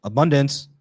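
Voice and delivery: ordinary talk, nothing marked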